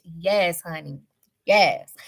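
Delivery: ordinary delivery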